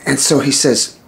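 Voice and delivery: ordinary delivery, nothing marked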